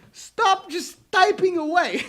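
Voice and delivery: loud, high shouting at the clerk; hammed-up outburst he never really made; pretend yell. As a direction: yell at him to stop typing. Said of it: "No, I didn't talk like this".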